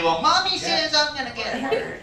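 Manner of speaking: high, soft embarrassed whine; high-pitched, embarrassed admission; embarrassed kid. Acted. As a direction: admit it sheepishly in a high little-kid voice. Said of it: "I was too embarrassed to say".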